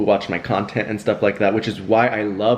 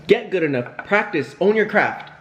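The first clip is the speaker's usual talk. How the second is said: higher, pep-talk lecture; lecturing; 7 semitones higher than usual. Real, not acted. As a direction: scold yourself like a coach. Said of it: "lecturing myself on camera".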